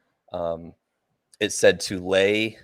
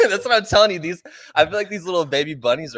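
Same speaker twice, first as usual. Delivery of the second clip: talking through giggles; laughing; 10 semitones higher, 4 dB louder, faster than usual. Real, not acted. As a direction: laugh while you say it. Laughing over baby bunnies.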